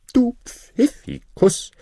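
high, slow, sing-song flattery; mock-admiring grinder. Grinder flattering Hans, high and teasing. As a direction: draw it out, teasing.

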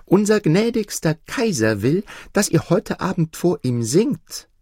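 put-on high child's voice; little girl. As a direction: ask sweetly like a little girl. Girl invites the nightingale.

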